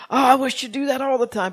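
high, loud, delighted exclamation; churchgoers gushing. Retold or imitated. Church members praising the preacher's lively sermon, loud and delighted. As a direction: gush like a thrilled church member. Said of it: "everybody in the church said".

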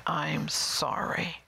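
slow, deliberate I'm sorry; sincere apology. Shown as a demonstration. Showing what God wants said, sincere apology. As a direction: say it once, very slowly and earnestly.

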